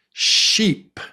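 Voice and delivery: "Sheep" said high and breathy with a long E; clear test word. Shown as a demonstration. Teacher gives a listening test word, long vowel. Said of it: "Listen, here's the word".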